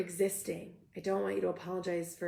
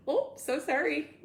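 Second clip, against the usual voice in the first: high, drawn-out Midwestern apology; slow, higher-pitched Midwestern "oh, so sorry"; Midwestern accent; 8 semitones higher, slower than usual. Acted. Host imitating a Midwesterner saying sorry, Midwestern accent. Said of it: "This is my Midwestern".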